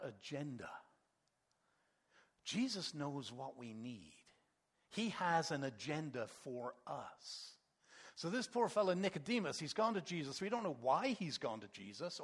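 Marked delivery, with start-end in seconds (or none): none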